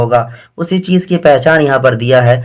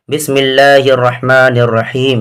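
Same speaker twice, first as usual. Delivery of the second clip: 1 slow recitation; slower than usual. Shown as a demonstration. Teacher recites before lesson.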